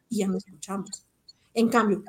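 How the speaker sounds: ordinary talk, nothing marked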